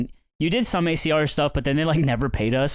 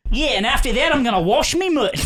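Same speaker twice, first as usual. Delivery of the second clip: a laughing jokey Australian twang; Australian accent impression; 8 semitones higher than usual. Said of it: "I'll try to do her accent".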